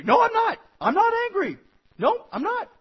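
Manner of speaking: loud, high-pitched mock protest; angry denial. Husband to wife saying he's angry, hammed-up denial.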